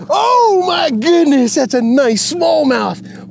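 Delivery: high, slow and drawn out in wonder; stretched-out amazement; awed delight. Real, not acted. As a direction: savor every word. Admiring the fish.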